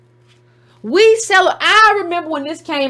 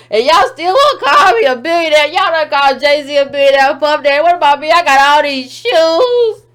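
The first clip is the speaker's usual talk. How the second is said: loud, whiny mock begging with fake crying; hammed-up tearful pleading; 2 semitones higher, 4 dB louder than usual. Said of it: "[name] out here begging Forbes".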